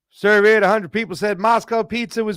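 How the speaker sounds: high, loud game show announcer; game show host. Acted.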